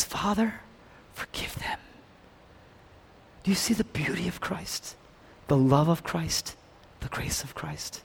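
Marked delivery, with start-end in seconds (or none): 0.0-1.8 higher "Father", then whispered "forgive them"; whispered plea. Acted. Pastor voicing Jesus on the cross, whispered plea. 7.0-8.0 whisper. Acted. Hushed sermon line.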